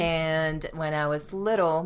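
ordinary talk

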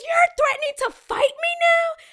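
very high, loud shocked shriek; shrill entitled customer. Retold or imitated.

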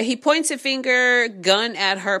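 normal talking voice

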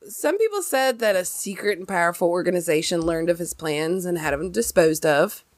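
usual speaking voice

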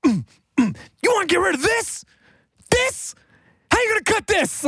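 very high, squeaky mock-incredulous voice. Rapper taunting the A and R guy, very high mocking voice. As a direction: soar up in pitch, daring them to cut it. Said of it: "then he's just like".

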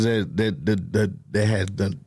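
repeating words, stuck on that; stuttering. Real, not acted. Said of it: "why the fuck I'm stuttering today?".

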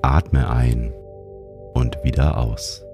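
ordinary talk

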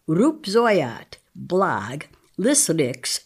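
slow, careful reading of each made-up name aloud; sounding out nonsense names. Shown as a demonstration. Reading invented names aloud for a radio experiment, careful and slow. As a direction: spell each odd name out in sound, one by one. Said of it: "read at first sight by Jean Berko Gleason".

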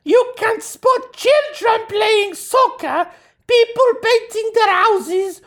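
squeaky put-on Norwegian accent. Shown as a demonstration. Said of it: "do it in Norwegian accent".

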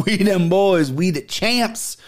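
mock victory boast. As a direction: crow it to the camera like a champ. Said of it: "looking in this camera, going".

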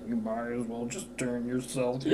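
dead man's taunt. Retold or imitated. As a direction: drag it out like a corpse talking. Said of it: "He was like".

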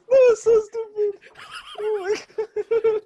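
high, slowed words through tearful laughter; laughing so hard it almost sounds like crying. Real, not acted. Cohost reacting to the nephew joke, tearful laughing.

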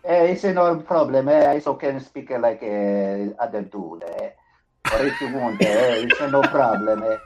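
hammed-up Italian tough guy; comic Italian mobster accent; mock Italian accent. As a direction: play a bad Italian accent with tacked-on vowels. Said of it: "Are you Italian now?".

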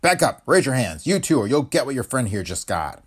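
menacing order, forceful from the first word; harsh command. Acted. Agent holding masked criminals at gunpoint, harsh command. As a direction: threaten them with a hard command. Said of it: "menaced him with a harsh command".

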